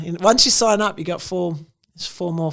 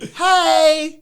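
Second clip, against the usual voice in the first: mock cheery hey; 12 semitones higher, 9 dB louder than usual. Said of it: "I started to be like".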